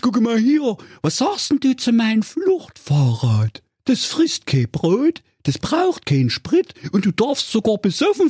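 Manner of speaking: high comic crook voice, oddly friendly; a cartoon crook, chatty and pleased; bank robber voice. A narrator's reading. The robber chats with Honk, high comic crook voice. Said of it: "Und er fuhr fort".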